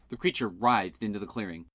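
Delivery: plain reading voice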